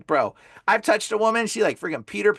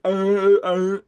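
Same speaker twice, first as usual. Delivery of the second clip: imitated vocal moaning; 5 dB louder than usual. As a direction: imitate wordless moaning, pushed out. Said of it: "she was like".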